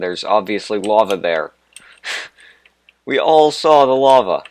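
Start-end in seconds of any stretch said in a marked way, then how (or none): 3.0-4.4 exasperated outburst. Real, not acted. Laughing at his own obvious remark.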